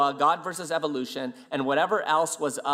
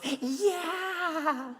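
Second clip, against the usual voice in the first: very high, drawn-out yeah; comic squeal, held long; mock squeaky admission; 13 semitones higher, 4 dB quieter than usual. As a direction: imitate a giddy confession in falsetto. Officer's sheepish admission, retold.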